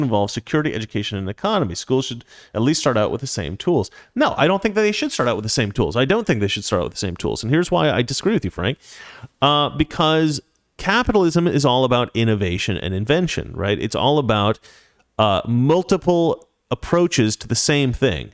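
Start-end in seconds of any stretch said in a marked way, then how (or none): none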